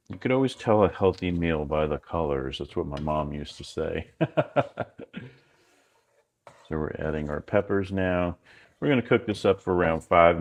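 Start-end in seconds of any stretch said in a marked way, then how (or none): 4.2-5.3 quick high chuckle; a brief amused laugh; short laugh. Real, not acted. Laughs at mom's saying.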